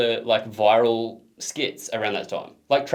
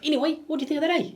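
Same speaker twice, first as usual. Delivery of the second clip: high mock Kiwi voice; hammy New Zealand accent; much faster than usual. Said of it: "that is a bad accent".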